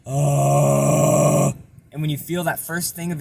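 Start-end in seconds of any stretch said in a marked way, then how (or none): 0.0-1.5 rattling voice cracking into air; vibrating false-chord distortion. Shown as a demonstration. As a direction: sound like talking through a fan. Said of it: "feel your throat start to vibrate".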